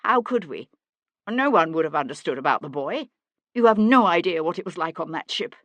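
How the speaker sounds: loud, pleading protest; anguished justification; desperate self-defence. A narrator's reading. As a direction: plead to be understood, forcefully. Accused man desperately defends himself.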